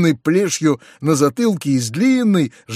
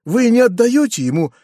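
higher-pitched, delighted disbelief; near-delighted cry; 8 semitones higher than usual. A narrator's reading. Nephew learns uncle keeps the village, near-delighted cry. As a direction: exclaim it high, almost in delight. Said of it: "вскричал я почти в восторге".

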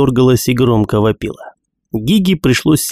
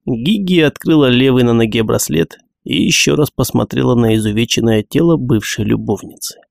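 ordinary delivery

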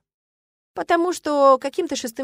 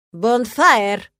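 loud, commanding call; sharp shout at a culprit; angry bark; 8 dB louder than usual. A narrator's reading. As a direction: bark the surname like a furious dean. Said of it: "рявкнул магистр Шарти".

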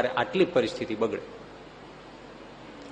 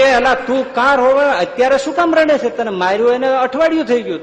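higher, louder baffled judge; puzzled judge; 9 semitones higher, 6 dB louder than usual. Retold or imitated. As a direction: demand why he is crying now. Judge scolds crying client.

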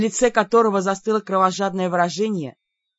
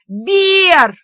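high, loud howl of protest; piercingly high, loud cry; 9 semitones higher, 9 dB louder than usual. A narrator's reading. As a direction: wail the name in earnest alarm. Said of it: "взвыла я уже всерьёз".

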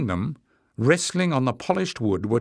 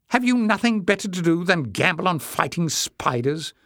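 higher-pitched, scornful question; disgusted demand; 8 semitones higher than usual. A narrator's reading. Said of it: "Jasmine demanded in disgust".

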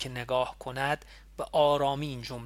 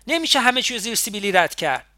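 shouting, much higher and louder; raised voice; 8 semitones higher, 8 dB louder than usual. A narrator's reading. Nima shouting back at Ali in their quarrel. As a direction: shout him down in anger. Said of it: "نیما هم صدایش رو بالا برد".

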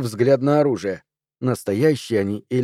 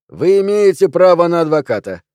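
loud, high official recital; policeman reading rights; 5 semitones higher, 6 dB louder than usual. A narrator's reading. Policeman reciting rights to a suspect, loud and high.

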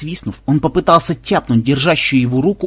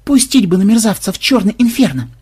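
bitter moan; 8 semitones higher, 4 dB louder than usual. A narrator's reading. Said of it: "с горечью простонал".

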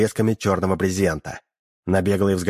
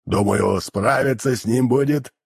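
demon voice. Acted. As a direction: drone it like a hellish creature. Demon keeps his voice.

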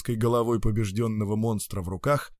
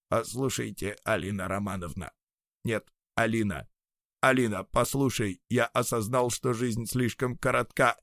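soft pleading; 3 dB quieter than usual. Acted. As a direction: plead with her gently. Robot head pleads softly.

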